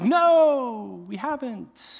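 high, drawn-out shout from afar; calling back. Retold or imitated. Disciples answer a far-off stranger, calling back. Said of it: "he's so far away".

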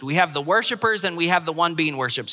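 fast, brisk run-through; quick recap. Shown as a demonstration. Pastor repeating his point for the congregation, quick and brisk. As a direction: rattle it off as a summary.

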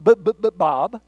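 imitated stammer, loud on the stutter; speech impediment. Man with impediment giving his name, mock stammer. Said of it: "has a bad impediment".

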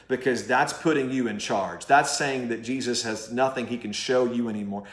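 usual speaking voice

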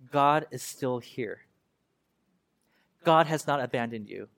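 low, slow, spaced-out assurance; solemn reassurance. Acted. God reassuring unfaithful people, low solemn comfort. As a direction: let each phrase settle, pitched low.

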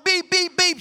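mock horn honks; lightly imitated horn beeps; imitated car horn.

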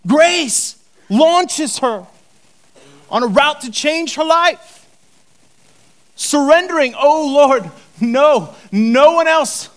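loud, high, slow build; impassioned preaching. Real, not acted. Preacher crying out to the congregation, impassioned. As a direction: preach it with rising fire.

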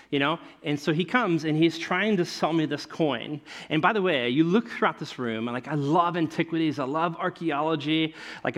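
ordinary delivery

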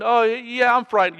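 high, loud, nervous stammer; scared Frodo. Retold or imitated. Acting frightened Frodo.

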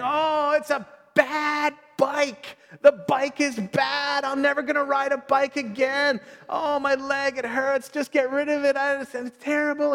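mock-tearful little boy blaming the bike; distraught kid. Father imitating his injured son wailing about the bike. Son screaming after crash.

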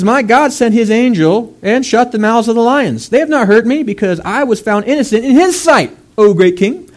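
proclaiming Daniel. Retold or imitated. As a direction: call up proudly from the den. Daniel answers the king from den.

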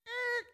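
short squeak of embarrassment; a comic high yelp; mock-horrified eek; high cringing eek. Cringing reaction to sinning.

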